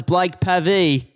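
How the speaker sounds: "Pavey" as PAV-ee, short a, slow; mispronounced surname. Retold or imitated. Radio host wraps up the interview, mispronouncing "Pavey" as PAV-ee. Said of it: "Uh, it's Pavey".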